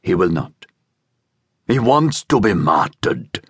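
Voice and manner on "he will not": lower, understated sadness; mildly sad resignation. A narrator's reading. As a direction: admit it with a touch of sorrow. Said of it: "Judas said sadly".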